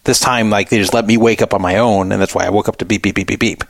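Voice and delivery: normal talking voice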